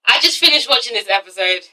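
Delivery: words spilling out through real laughter; laughing. Real, not acted. Opening her show review, laughing through the words. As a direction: giggle your way through the line. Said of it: "you guys probably know why I'm laughing".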